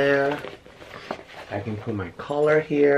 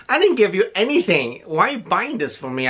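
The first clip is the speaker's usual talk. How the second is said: higher, louder mock protest to a friend; retold surprised protest; 8 semitones higher, 3 dB louder than usual. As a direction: replay your own shocked "why would you". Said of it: "I'm like".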